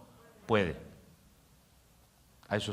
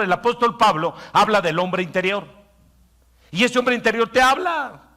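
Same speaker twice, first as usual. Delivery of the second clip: higher, louder preaching drive; full-force preaching; 10 semitones higher, 7 dB louder than usual. Acted. Pastor preaching hard to the congregation, full force.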